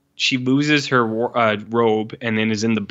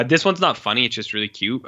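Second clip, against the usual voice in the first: normal talking voice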